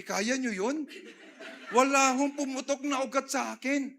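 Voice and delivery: rising preacher's exclamation of healing; loud preaching. Real, not acted. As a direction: proclaim it to the congregation at full force. Preacher building to a climax.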